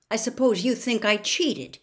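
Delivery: irritated defensive snap; aggravated. A narrator's reading. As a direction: push back, stung and irritated. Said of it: "aggravated by her expression".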